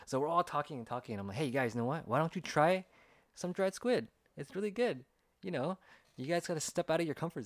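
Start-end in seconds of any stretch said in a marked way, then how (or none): 1.4-7.4 high-pitched, cajoling pitch; coaxing. Retold or imitated. Offering American coworkers dried squid, acted coaxing. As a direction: coax them in a raised, eager tone.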